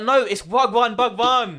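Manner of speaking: loud, high retold outburst, breaking into laughter; exasperated correction. Listener yelling the missed joke at the hosts, exasperated. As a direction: shout the correction at the podcast. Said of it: "And I'm like".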